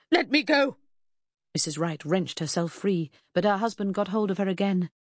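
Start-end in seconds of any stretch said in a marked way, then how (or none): 0.1-0.8 high, loud protest; frantic demand. A narrator's reading. Wife struggling in husband's grip, frantic and loud. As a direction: cry out to be released.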